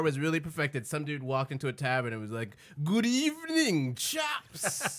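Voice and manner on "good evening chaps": slow, lilting faux-posh greeting; a hint of a camp Victorian gentleman.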